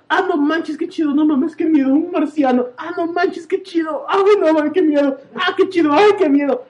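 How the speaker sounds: high, loud torn between awe and fright; panicky retold reaction. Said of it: "y decir". (entre emocionado y asustado, agudo)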